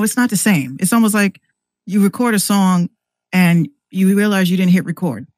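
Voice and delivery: ordinary talk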